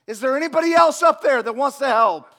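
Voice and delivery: high, loud frantic shout for anyone else; man on the cliff yells for someone else; panicked yell. Retold or imitated. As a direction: scream up past God for another helper. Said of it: "still panic".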